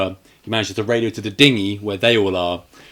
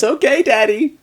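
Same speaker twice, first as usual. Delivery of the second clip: put-on little girl voice; 13 semitones higher than usual. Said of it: "And she goes".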